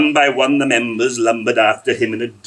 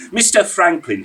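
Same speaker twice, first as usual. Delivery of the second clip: ordinary delivery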